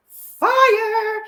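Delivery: stretched, high hype on fire; squealing hype; excited. Real, not acted. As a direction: drag out fire with big excitement. Hyped about new show.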